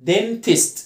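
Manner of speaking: "dentist" with open e, short i; two-syllable demo. Shown as a demonstration. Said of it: "مقطعين".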